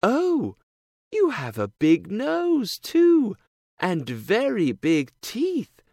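put-on high, amazed little girl; surprised girl. A narrator's reading. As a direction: squeal it in wonder. Girl's voice.

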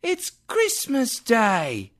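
put-on high, slow boy voice; a street boy answering simply. A narrator's reading.

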